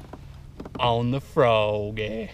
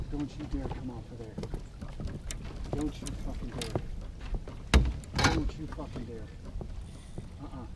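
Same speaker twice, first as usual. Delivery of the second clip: hushed, fast pleading to the fish; tense whisper; 14 dB quieter, faster than usual. Real, not acted. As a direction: beg the fish under your breath. Pleading with fish during fight.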